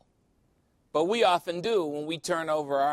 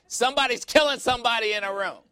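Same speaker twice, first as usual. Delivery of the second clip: high, loud imitation of a panicked hotel guest; mock alarm; 8 semitones higher, 5 dB louder, faster than usual. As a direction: call out for security in hammed-up alarm. Hotel guests alarmed by screaming fight.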